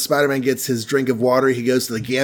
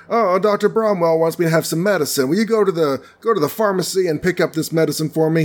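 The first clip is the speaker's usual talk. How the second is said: higher put-on voice asking a favor; lightly acted aunt, pitched up; Aunt May; 5 semitones higher than usual. Aunt asks her nephew to fetch medicine, higher pleading voice. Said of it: "Aunt May's like".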